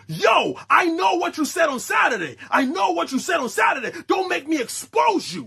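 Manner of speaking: hammed-up yelling homie; mock threat. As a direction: call him out across the table.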